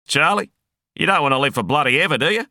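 high fast yell over the wind; loud, high-pitched taunt called out at speed; yelling, laughing. A narrator's reading. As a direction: shout it manically over a roaring engine. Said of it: "Roberts was laughing, yelling over the wind".

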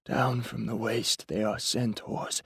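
slow, wheezy stage line, weakly delivered; wheezy, barely audible. A narrator's reading. Stricken actor says the wrong line on stage, wheezy. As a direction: eke the line out on failing breath. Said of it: "the delivery was wheezy, his voice barely audible".

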